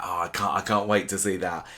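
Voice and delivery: usual speaking voice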